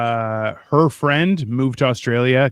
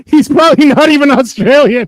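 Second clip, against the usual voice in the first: laughing mockery; 11 semitones higher, 10 dB louder than usual. Real, not acted.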